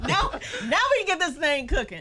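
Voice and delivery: high, loud and laughing. Real, not acted. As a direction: laugh it out excitedly. Laughing excited reply.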